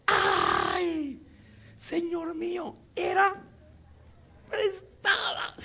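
very high, drawn-out mock wail; anguished cry. Man who lost a borrowed axe to the prophet, acted anguished cry. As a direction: cry out in hammed-up despair. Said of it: "gritó diciendo".

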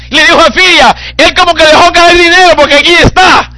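loud, high, impassioned sermon cry; full-force preaching. Acted. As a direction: preach it out with fire. (predicación apasionada a gritos)

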